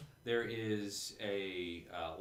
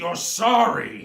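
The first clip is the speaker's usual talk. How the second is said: loud, slow, mocking monster; mocking villain voice; 11 semitones higher, 17 dB louder, slower than usual. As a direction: sneer it like a villain. Monster mocks apologizing player.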